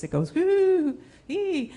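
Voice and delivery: high swooping whoo-ee; whooshing mind noise. Shown as a demonstration. Teller showing how her mind drifts, swooping whoo. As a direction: make the sound of a mind flying off. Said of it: "my mind often does this".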